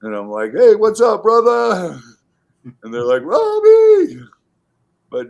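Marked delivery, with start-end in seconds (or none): 0.5-2.0 big high-pitched holler to a buddy; boisterous hail, acted out; shouted greeting. Re-enacts hailing friends on livestream. 3.2-4.2 very high, loud imitated cheer; whooping shout of a name; excited name holler. Imitates friends hollering his name back.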